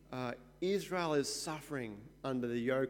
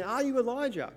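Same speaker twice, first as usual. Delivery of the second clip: much higher, urgent question; eager questioning; 8 semitones higher than usual. Retold or imitated. Delegation pressing John, higher and eager. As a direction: press him hopefully for an answer. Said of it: "And so they're saying".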